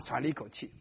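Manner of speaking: ordinary talk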